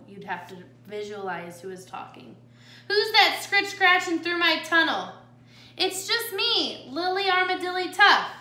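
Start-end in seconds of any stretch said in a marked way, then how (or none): 2.9-5.1 loud, raised coyote voice; coyote challenge. Shown as a demonstration. Coyote challenges whoever is in the tunnel, voice raised. Acts out coyote's line for scene. 5.8-8.3 little armadillo sister. Shown as a demonstration. Lily answers the coyote in the tunnel, high-pitched.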